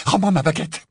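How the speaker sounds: high, angry demand; exigence furieuse. Acted. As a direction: demand it back heatedly. Hermione exige sa baguette. (ordre furieux, voix haute)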